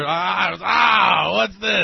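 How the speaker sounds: high, loud startled yell; mother's shout. Retold or imitated. Mother discovering the carrot fries, shouting in alarm. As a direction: shriek in shock at the fake fries. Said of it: "And she said".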